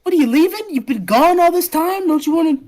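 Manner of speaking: high, loud, indignant plea; pleading protest. Acted. Girl begging old friend not to leave, upset. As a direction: burst out to stop them going.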